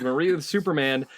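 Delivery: normal talking voice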